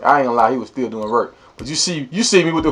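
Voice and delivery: usual speaking voice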